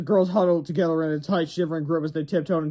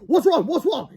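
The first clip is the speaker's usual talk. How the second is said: much higher, louder principal; rushing in alarmed; gruff yell; 12 semitones higher, 3 dB louder than usual. Acted. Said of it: "yelled Mister Crump".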